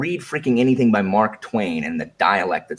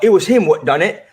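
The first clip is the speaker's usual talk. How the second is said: higher, louder hint of a British accent; mock British dialect; 7 semitones higher, 6 dB louder than usual. Shown as a demonstration. To book club friends, louder mock British quote. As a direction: punch out the British line for effect. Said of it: "That person's English".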